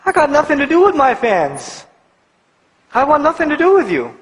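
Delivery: imitated disowning. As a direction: imitate someone washing their hands of fans.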